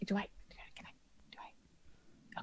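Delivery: unvoiced, hurried whisper; whispered panic about paying gold; panicked whisper. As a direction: fret in a whisper.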